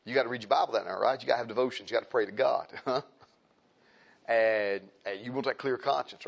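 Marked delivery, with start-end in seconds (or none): none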